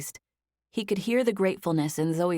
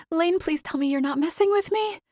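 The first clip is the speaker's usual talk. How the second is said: high, strained voice near tears; high-pitched; 12 semitones higher than usual. A narrator's reading. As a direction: pitch it up, holding back tears. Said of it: "Her voice was high-pitched".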